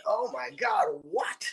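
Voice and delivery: high pitched disbelieving oh my God, what; stunned disbelief. Retold or imitated. Hearing from his mom that [name] called, high stunned what. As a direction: squeak out shocked disbelief. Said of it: "I'm like".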